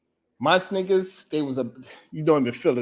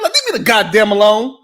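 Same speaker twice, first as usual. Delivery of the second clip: louder, annoyed retort, exhausted runner; grumpy snap back; 9 semitones higher, 10 dB louder than usual. Retold or imitated. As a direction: bark it back at the cheering family. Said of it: "and they be like".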